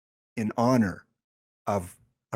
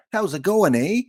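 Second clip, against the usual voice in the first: higher, louder hammed-up Canadian greeting; mock Canadian accent; 7 semitones higher, 5 dB louder than usual. Streamer greeting viewers in Canada, mock Canadian accent. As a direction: play up a Canadian accent with a tagged-on "eh". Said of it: "If you're in Canada".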